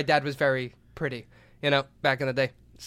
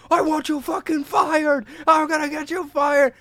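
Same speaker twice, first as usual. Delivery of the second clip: furious shouted threat; 10 semitones higher, 5 dB louder than usual. Retold or imitated. Enraged coworker screaming at the man who beat him.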